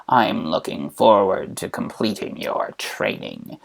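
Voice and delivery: soft, low, sinister Emperor; Palpy. Acted. Emperor promising to train his captive, low soft Palpy voice. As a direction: draw it out low, savouring the menace. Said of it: "And he says".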